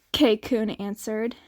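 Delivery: ordinary delivery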